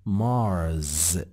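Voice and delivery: "مارز" drawn out slowly, ending in a clear z, rising; Mars with z. Shown as a demonstration. (مارز را با «ز» بگو نه «س»)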